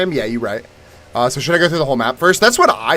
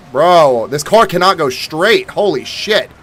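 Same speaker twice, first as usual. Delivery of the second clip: loud, high exasperated shout; frustrated outburst; 5 semitones higher, 7 dB louder, slower than usual. Real, not acted. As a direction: yell at the car, higher. Car won't go straight.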